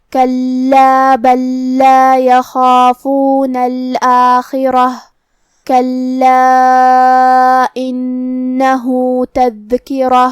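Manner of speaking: slow, drawn-out tajweed chanting; measured Quranic tilawat with long vowels; Quran recitation. Acted. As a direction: recite the verse slowly and melodically. Teacher recites Quran verse.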